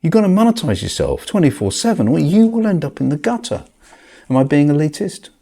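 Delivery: loud, high exasperated rant; back in my day rant. Real, not acted. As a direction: vent about rising costs, loudly. Rant about rent, prices, gutter.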